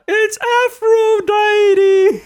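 very high, slow drawn-out cry; slow motion. Retold or imitated. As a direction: stretch Aphrodite in slow motion. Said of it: "saying in slow motion".

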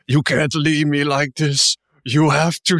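slow, grand theatrical plea; Shakespearean corpse. Retold or imitated. Corpse begging Julia not to leave, slow and theatrical. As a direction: declaim it like a stage tragedian. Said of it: "this Shakespearean corpse".

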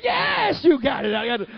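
high mock shout of joy; jubilant confirmation. Church mother tells child "got it", jubilant shout. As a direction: whoop it out, hammed-up and high.

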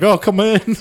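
high, playful "come in"; mock welcome. Friend imagines inviting the right knocker in, high mock welcome. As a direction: welcome the right person in, hamming it up. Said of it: "be like".

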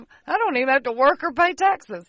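hammed-up carefree guy bragging; loud goofy voice of a freeloader; mock dude voice. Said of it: "He's like".

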